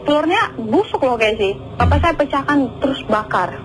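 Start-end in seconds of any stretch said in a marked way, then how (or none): none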